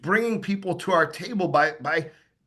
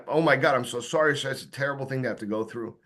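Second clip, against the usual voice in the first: low, sympathetic, trailing softer; sincere condolence; 4 semitones lower, 3 dB quieter than usual. Shown as a demonstration. Salesman to a man who lost his wife, sincere condolence. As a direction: offer quiet, heartfelt sympathy. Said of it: "you're gonna sound sincere".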